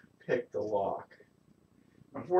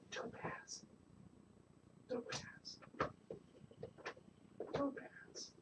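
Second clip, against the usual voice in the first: whispered calling; 12 semitones higher, 20 dB quieter, much slower than usual. Real, not acted. Owner coaxing hiding cat Topaz, whispering her name. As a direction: whisper the cat's name gently.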